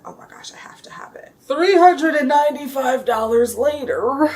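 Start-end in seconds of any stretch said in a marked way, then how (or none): 0.0-1.3 whispered gasp. Retold or imitated. Retelling her reaction at the counter.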